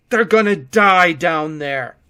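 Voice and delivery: shout. A narrator's reading. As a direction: shout in desperation. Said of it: "I shouted".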